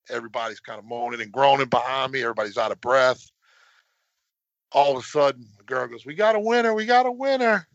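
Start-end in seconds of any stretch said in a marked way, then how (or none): 6.1-7.7 high, bright call of the winner; excited announcement. Retold or imitated. Race girl announces the winner to the kids, excited call. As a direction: shout it out like a race official.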